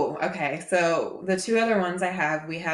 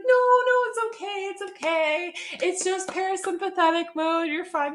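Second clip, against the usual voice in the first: very high-pitched, soothing protest; hurried reassurance; 12 semitones higher than usual. Retold or imitated. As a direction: wave away his embarrassment in a high voice. Therapist rushing to reassure embarrassed client.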